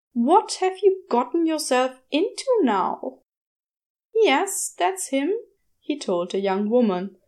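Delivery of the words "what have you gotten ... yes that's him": a lightly raised, fussy gentleman; a hint of a prim, worried angel; Aziraphale's voice. A narrator's reading. Aziraphale answers the shop girl's call, lightly voiced worried gentleman.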